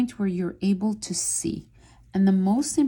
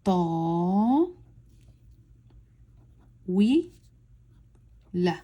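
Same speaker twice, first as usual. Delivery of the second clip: "ta, wi, la" stretched, with long gaps between syllables; as slow as possible; much slower than usual. Shown as a demonstration. Teacher reading for novice learners, as slowly as possible. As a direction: say "ta", "wi", "la" extremely slowly, each alone. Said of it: "as slowly as possible".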